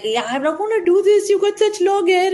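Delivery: high, worked-up protest, acted out; imitated frantic hairstylist. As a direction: mimic a stylist refusing in a flap. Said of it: "all, all crazy".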